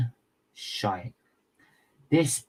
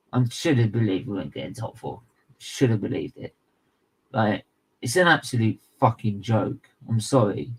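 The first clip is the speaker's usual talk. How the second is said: ordinary talk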